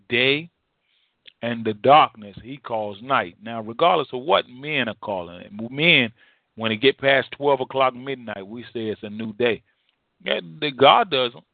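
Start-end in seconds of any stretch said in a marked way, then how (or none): none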